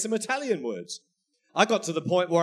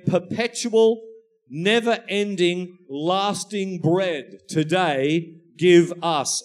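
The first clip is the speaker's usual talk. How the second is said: slow, weighty, loud prayer line; slow emphatic prayer; 4 dB louder, slower than usual. Shown as a demonstration. Preacher slowly reading Jesus's prayer to his congregation. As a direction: lay out each word deliberately. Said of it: "He's saying".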